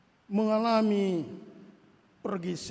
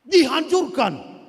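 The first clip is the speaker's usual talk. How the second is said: loud verdict; 4 semitones higher, 10 dB louder, slower than usual. Acted. Preacher answers the boast.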